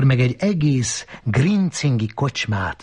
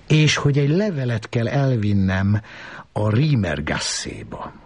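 ordinary delivery

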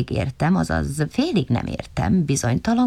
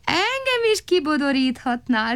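sighing; 11 semitones higher than usual. A narrator's reading. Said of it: "sóhajtott Szeréna".

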